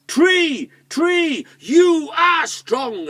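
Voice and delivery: high, loud shout to the tree; big storybook shout; calling out. Acted. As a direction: call out big, drawing out the last words. Tom calls to the tree.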